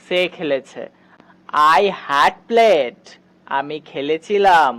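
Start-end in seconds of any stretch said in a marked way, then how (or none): 1.8-3.0 very slow, stretched out; slow model sentence. Shown as a demonstration. Teacher slowly modelling the English example. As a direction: lay each word down for learners. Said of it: "যেমন".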